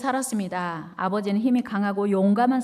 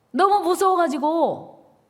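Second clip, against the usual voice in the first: dramatic build; 8 semitones higher, 6 dB louder, slower than usual. A narrator's reading. Climax of fear.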